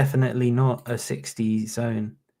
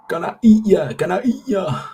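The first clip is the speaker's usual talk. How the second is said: mock predator; 7 semitones higher, 5 dB louder than usual. Hyping himself on the video.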